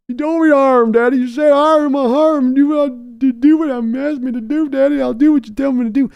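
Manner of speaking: high, loud put-on son's voice; Steven answering. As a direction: answer Daddy like a cowed grown son. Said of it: "yelling at Steven".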